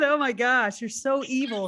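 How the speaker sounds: normal talking voice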